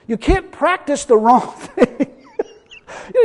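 laughing. Real, not acted. Laughs mid-point while preaching.

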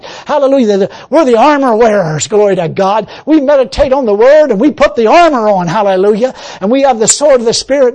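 loud, rising preaching prayer; fervent prayer. Acted. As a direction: pray it out with full conviction.

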